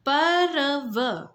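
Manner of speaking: पर्व stretched very slowly, र clearly sounded before व; drawn-out pronunciation. Shown as a demonstration. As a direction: draw पर्व out to show the र. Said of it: "व के पहले र का उच्चारण हो रहा है". (पर्व को धीरे खींचकर, व से पहले र साफ़ बोलना)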